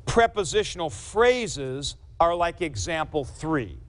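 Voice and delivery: usual speaking voice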